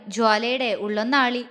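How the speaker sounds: usual speaking voice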